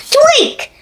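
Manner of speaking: sharp high tweak sound; high squeak. A narrator's reading.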